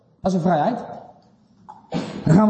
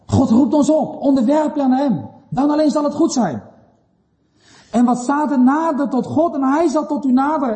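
raised, urgent preacher's pitch; emphatic preaching; 7 semitones higher than usual. Real, not acted. Preacher calling the congregation to submit, emphatic preaching. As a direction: call the listeners to respond, pitched high.

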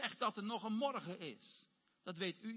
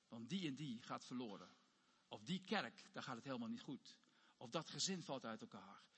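low, soft intercession; acted prayer plea; 8 semitones lower, 8 dB quieter than usual. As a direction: pray it quietly and gravely, voice held down. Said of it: "maar die roepen". (zacht, ingehouden smeekgebed)

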